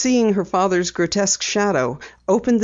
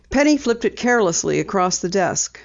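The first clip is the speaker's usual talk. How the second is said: ordinary delivery, nothing marked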